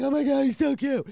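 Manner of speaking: high, loud gushing crush; mock lovesick gush. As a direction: squeal it like a smitten girl. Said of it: "just going".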